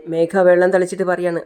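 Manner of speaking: plain reading voice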